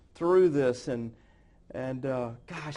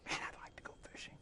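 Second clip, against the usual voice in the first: put-on whispered boyish longing; wistful whisper; 17 dB quieter, faster than usual. Boy watching his dad go fishing, whispered. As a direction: breathe it like a kid left behind. Child watching father leave.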